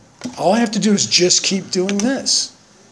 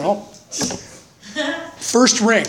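laughing; 7 semitones higher, much slower than usual. Real, not acted. Laughing at the punchline.

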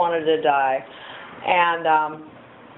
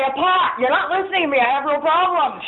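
indignant; 8 semitones higher than usual. Retold or imitated. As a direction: brush off the question, you're not listening. Insisting to sponsor.

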